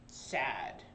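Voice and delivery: low, soft sad voice; a soft sad sigh of a word. Shown as a demonstration. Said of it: "is sad".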